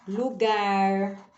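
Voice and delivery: slow, stretched words with long pauses; drawn-out syllables, teacher at the board; dictation. Shown as a demonstration. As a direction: say each word as you write it. Teacher saying words while writing them.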